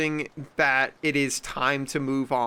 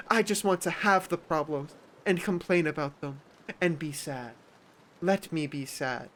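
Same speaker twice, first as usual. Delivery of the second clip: higher, whiny, overdramatic victim; mock self-pity; 3 semitones higher than usual. Mocking attention seekers, high whiny self-pity. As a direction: whine like a drama queen. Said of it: "they're like".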